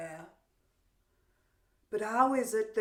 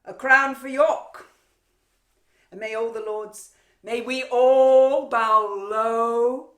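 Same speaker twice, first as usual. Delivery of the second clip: loud, ceremonious proclamation; slow, swelling mock homage; mock coronation; 3 semitones higher, 13 dB louder than usual. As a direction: announce it grandly, dripping with ridicule.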